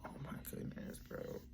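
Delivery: whispered, overwhelmed re-enactment of crying; breath-only whisper, mock tearful; retold tearful reaction. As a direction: act out being overcome, barely voiced. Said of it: "Then I started crying".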